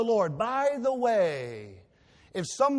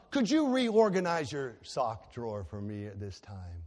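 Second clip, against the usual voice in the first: mock request; 7 semitones lower than usual. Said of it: "I don't want to say things like".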